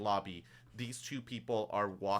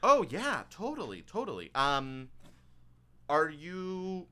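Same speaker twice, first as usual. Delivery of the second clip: higher-pitched, eager customer-service voice; perky bank assistant; 9 semitones higher, 4 dB louder than usual. Acted. Bank assistant asking new customers about an account, perky and high. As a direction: play an obliging bank clerk, pitched up.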